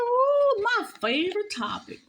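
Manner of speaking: high, swooping, excited coo; delighted. Real, not acted. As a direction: squeal over a beloved subject. Reads out her card's topic excitedly.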